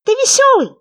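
high, loud, squeaky little frog; small creature's high, loud voice. A narrator's reading. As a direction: pipe up eagerly as a tiny frog. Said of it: "сказал лягушонок".

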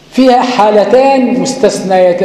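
normal talking voice

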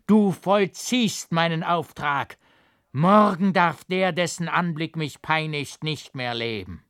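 a woman in a fury who will not be refused; raging passion. A narrator's reading. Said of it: "von der wütenden Leidenschaft, die in ihr tobte".

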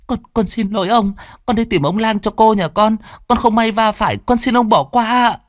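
high, timid and apologetic; humble maid apologising to a stranger; servant girl voice. Acted. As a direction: beg forgiveness for bumping him.

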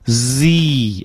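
"Z" as a buzzing z into a long ee; long, smooth "Z"; ends on ee. Shown as a demonstration. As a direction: stretch the final ee of "Z". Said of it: "最后元音落在这个一上".

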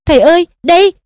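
urgent cry. A narrator's reading. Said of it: "Harry thét lên". (thét gọi khẩn cấp)

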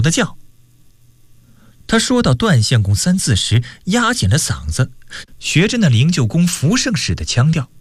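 animated storyteller with acted dialogue; story narration. A narrator's reading. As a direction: narrate the tale with wide swings in pitch. Narrates a novel chapter. (绘声绘色地讲小说)

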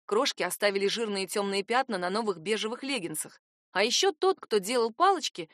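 plain reading voice

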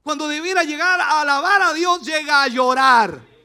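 loud, rising preacher's cry; full-force sermon voice; preaching at full force. Acted. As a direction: drive it home to the congregation.

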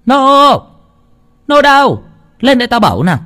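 high, loud summons; shouting for Nô to come up; calling out angrily. A narrator's reading. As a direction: yell for the servant Nô from the yard. Bà Phú calling the servant.

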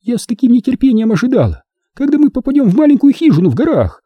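very high, aggrieved complaint; indignant. A narrator's reading. Woman complains about her lost mountain holiday, indignant. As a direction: protest high, full of outrage. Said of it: "заговорила Лайза с возмущением".

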